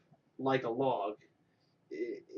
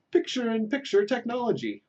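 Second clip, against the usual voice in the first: "picture-in-picture technology" pitched way up, louder; excited announcer; 10 semitones higher, 5 dB louder than usual. Shown as a demonstration. Host promises picture-in-picture next week, excited and high. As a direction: sell the words like a gadget ad. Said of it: "host announces new feature".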